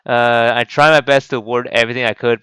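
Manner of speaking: ordinary talk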